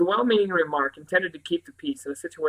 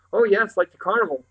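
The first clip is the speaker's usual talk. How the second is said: mildly dismissive, higher and louder; 5 semitones higher, 5 dB louder than usual. A narrator's reading. Boy comparing it to the carnival, mildly dismissive. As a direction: lightly brush it off. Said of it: "Barney said dismissively".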